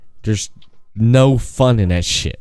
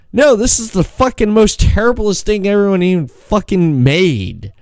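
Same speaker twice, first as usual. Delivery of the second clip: higher, exaggerated disgust; mock outrage; 8 semitones higher than usual. Telling his friend the game is terrible, mock outrage. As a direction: rant at the game like it wronged you. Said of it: "I was like".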